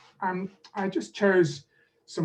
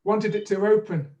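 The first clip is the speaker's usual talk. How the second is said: teacher leading a repetition drill; 3 dB louder than usual. Shown as a demonstration. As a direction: clear modelling for the student. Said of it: "repeat after me".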